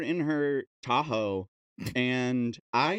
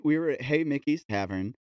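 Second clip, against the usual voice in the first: usual speaking voice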